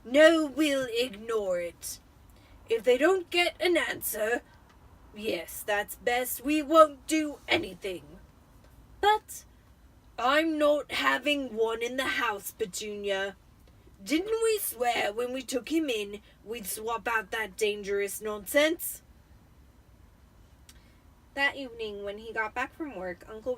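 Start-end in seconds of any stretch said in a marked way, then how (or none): 0.0-8.2 loud, slow, heavy uncle laying down the law; stern Uncle Vernon. Acted. Uncle deciding with his wife to ignore the letters, loud and slow. 9.0-9.4 high, loud startled "But"; a sharp high cut-in from the aunt; aunt's protest. Acted. Wife interrupting her husband, high and loud. 10.2-19.0 loud, slow, blustering uncle; stern Uncle Vernon. Acted. Uncle refusing his wife, loud and slow.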